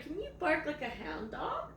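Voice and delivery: ordinary delivery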